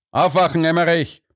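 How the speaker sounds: sharp call, pitched well up. A narrator's reading. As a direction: call out to rouse a sleeper. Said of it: "rief er und wiederholte den Vorgang".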